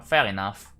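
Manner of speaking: ordinary talk, nothing marked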